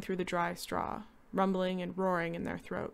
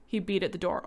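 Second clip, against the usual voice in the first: ordinary delivery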